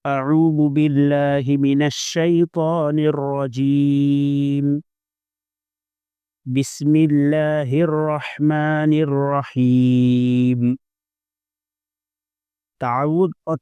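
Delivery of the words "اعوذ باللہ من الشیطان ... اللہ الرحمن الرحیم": slow, drawn-out melodic recitation; Quran recitation. Shown as a demonstration. Teacher reciting to students, slow and melodic. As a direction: recite each word long and measured.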